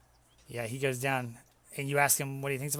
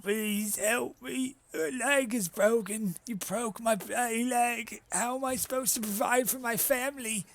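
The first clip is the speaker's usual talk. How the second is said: high, slow tearful begging; pleading, half crying; 9 semitones higher, slower than usual. Acted. The injured giant begs the hero for help, high tearful pleading. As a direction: beg for help through tears. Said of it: "he starts crying a little bit".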